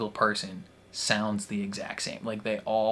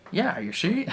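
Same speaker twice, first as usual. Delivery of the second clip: mock old Hollywood transatlantic lilt, pitched up; playfully imitating a transatlantic accent, little-girl version; 11 semitones higher, 3 dB louder than usual. A fan doing the child actor's transatlantic accent, pitched high. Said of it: "heavily, like, transatlantic accent".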